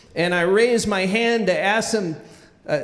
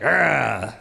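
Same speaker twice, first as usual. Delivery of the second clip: low imitated growl; 7 semitones lower than usual. Soaked coach glaring at the kid, low growl. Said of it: "he's growling at me like".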